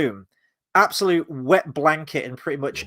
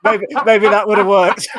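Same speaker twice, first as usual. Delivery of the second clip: laughing; 6 semitones higher, 10 dB louder than usual. Real, not acted. Both laughing at the idea.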